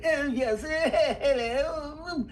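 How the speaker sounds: put-on high, chortling English butler accent. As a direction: play a tittering English manservant. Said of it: "I did a butler for".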